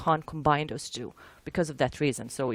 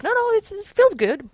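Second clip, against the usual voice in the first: high-pitched, louder quoted reassurance; dismissive reassurance; 6 dB louder than usual. Retold or imitated. Team insisting the missile is fine, high-pitched reassurance. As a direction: wave off doubts in a raised, high voice. Acting out team's confident reaction.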